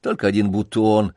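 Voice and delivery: ordinary delivery, nothing marked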